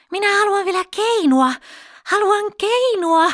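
high, loud tantrum; angry child's shout. A narrator's reading. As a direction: insist at the top of a little girl's voice. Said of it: "Pippa huusi vihaisesti".

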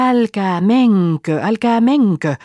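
loud, high shout after runaways; calling out. Acted. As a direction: call them back at full voice, stretching the words. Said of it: "huudan niitä". (kovaa perään huutaen)

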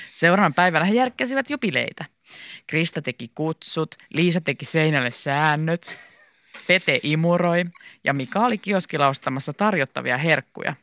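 ordinary delivery

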